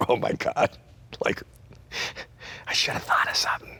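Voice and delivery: rushed, breathless whisper of panic; panicked whisper. Retold or imitated. Frozen comic on stage whispering to himself in panic. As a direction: hiss it fast to yourself, terrified.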